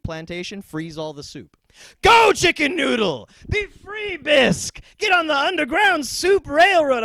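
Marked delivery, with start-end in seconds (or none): 2.0-7.0 high, loud liberating shout; hammed-up heroic liberator shout; rallying cry. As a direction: cheer the soup on to freedom. Freeing the soup.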